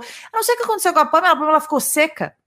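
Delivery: fast, high imitation of a friend's complaint; mock complaint. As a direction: rattle it off like a friend gossiping. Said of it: "ela falou".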